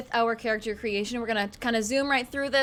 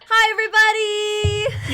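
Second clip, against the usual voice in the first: loud, high cheerful hello; excited greeting; 10 semitones higher, 8 dB louder than usual. Real, not acted. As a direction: call out a big hello to everyone. Greeting cast on stream.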